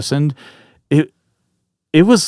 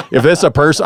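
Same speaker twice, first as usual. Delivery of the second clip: raised-voice retold warning, higher than usual; mock yell of a warning; 7 semitones higher, 5 dB louder than usual. Camper warning a possible intruder, retold yell. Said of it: "I yelled".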